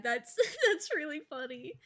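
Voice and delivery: a laugh breaking into the words. Real, not acted. Still laughing at the chat, talking through giggles.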